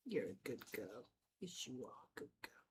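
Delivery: low, hushed murmur to a cat; soft pet praise. Real, not acted. Praising the cat she is petting.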